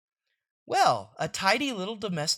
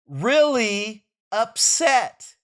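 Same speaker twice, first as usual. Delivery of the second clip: Really dragged out, slow and pointed; stress on really; 2 semitones higher, 4 dB louder, much slower than usual. Acted. Mother pressing father about the upset son, slow stress on Really. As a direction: stretch the word Really very slowly for emphasis. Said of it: "I said I'll bet he is".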